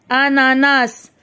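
"ananas" slowly, syllables drawn out. Shown as a demonstration. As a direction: read "ananas" slowly as a-na-nas. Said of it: "نقراو les syllabes". ("ananas" مقطعًا مقطعًا ببطء)

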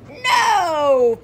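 big gasp then loud high no; mock gasp and no. Teacher reading to children, gasp then loud shocked no. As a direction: act shocked for the kids. Reacting to story question.

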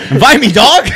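high, loud, laughing complaint; laughing mock plea. Real, not acted. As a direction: plead jokingly through laughter, loud and high. Mock complaint about missed parties.

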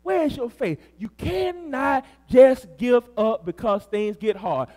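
ordinary talk, nothing marked

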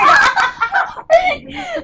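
helium giggle; high squeaky laugh. Real, not acted. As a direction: laugh in a chipmunk voice.

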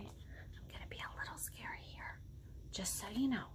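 hushed aside to the listeners; very soft confiding whisper; whispered warning. Real, not acted. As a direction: whisper a gentle heads-up. Warns listeners before the roar.

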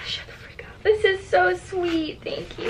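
whispered, overcome with happy tears; tearful whisper. Real, not acted. Vlogger thanks shop owner for shirt, tearful whisper. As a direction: barely get the words out, deeply touched. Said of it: "I'm literally tearing up".

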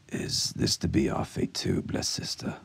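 low, hushed dread; voicing fear. A narrator's reading. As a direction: murmur the shared fear, understated. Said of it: "giving voice to the fear in all their hearts".

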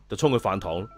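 ordinary delivery, nothing marked

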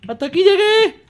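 high, loud outburst; exclaiming shout. Real, not acted. Gamer announces how far they got, exclaiming shout. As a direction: cry it out at full voice.

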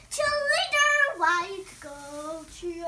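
slow, loud cheer chant; sing-song cheerleader cheer. Acted. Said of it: "And here is the".

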